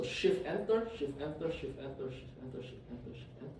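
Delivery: steadily sinking to a near whisper; fading repetition. Real, not acted. Teacher running code and repeating keystrokes, fading away.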